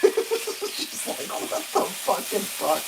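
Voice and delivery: slow, laughing through the words; can't believe it, giggling; slow incredulous laugh-talk; laughing disbelief. Real, not acted.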